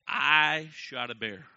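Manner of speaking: "I" drawn out long and loud; insistent stress on I. Retold or imitated. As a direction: lean hard and slowly on "I". Insisting after being doubted.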